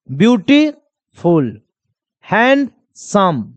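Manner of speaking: "Beautiful, handsome" very slowly, split into parts; drawn-out syllable-by-syllable "beautiful, handsome"; broken into syllables. Shown as a demonstration. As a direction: pause inside "beautiful" and "handsome" to show the breaks. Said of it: "तोड़ के देखो".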